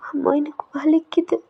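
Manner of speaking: whispering to her mother that it hurts; pained whisper. Acted. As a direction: breathe it out, hurting. Daughter confesses pain to mother.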